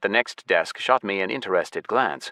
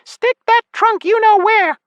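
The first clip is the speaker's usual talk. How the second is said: tiny furious fairy, very high and loud; shriek; 9 dB louder than usual. A narrator's reading. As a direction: squeal it as a parting insult. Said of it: "she shrieked".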